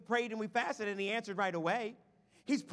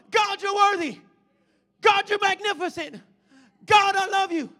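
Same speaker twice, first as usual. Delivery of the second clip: high, shouted praise; exuberant group; 6 semitones higher, 10 dB louder than usual. Acted. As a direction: belt it out to God. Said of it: "you've got another group going".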